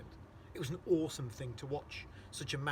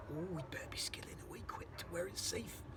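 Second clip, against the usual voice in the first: urgent giant's whisper; 4 semitones higher, 6 dB quieter than usual. Acted. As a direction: hush it so the giant won't wake. BFG whispering while hiding.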